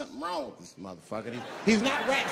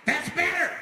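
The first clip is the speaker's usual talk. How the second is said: high, loud crazed approval; loud, manic satisfaction; menacing approval; 12 semitones higher, 5 dB louder than usual. Acted. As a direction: shout it high, as the man in control. Flipped-out man now appeased.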